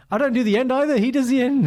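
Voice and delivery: high-pitched mock protest; retold protest. Retelling his protest about doing the ending, high-pitched. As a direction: push the whole line up high, as if objecting.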